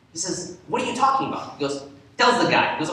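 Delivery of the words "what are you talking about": high baffled question. Retold or imitated. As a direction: sound lost and annoyed. Scholar baffled, pitched high.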